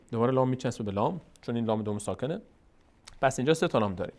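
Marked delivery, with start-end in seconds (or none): none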